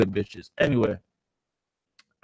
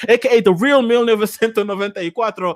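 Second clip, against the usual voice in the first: put-on higher-pitched Nigerian accent; 6 semitones higher, 4 dB louder than usual. Streamer retrying the nickname he hates, Nigerian accent. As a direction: announce the Spanish-number nickname in a Nigerian accent. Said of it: "I'll do it in my Nigerian accent".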